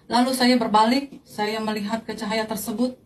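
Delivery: normal talking voice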